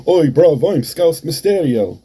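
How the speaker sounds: mock British accent, loud and high; Scouse Mysterio. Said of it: "one little promo with that accent".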